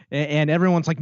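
ordinary talk

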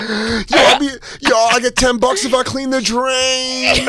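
put-on high, slow kid boast. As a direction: brag like a little kid. Mocking kid nephews.

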